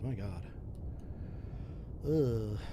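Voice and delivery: ordinary talk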